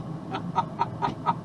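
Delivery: soft unvoiced chuckle; breathy laugh. Real, not acted. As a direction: laugh under your breath. Laughing after retold quote.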